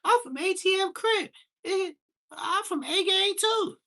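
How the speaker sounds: put-on squeaky little kid bragging about his gang; high kid voice. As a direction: pitch way up like a small child. Kid voices claiming gangs.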